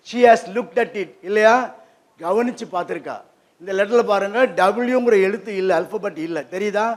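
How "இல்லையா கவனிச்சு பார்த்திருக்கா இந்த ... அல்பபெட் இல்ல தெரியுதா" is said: ordinary delivery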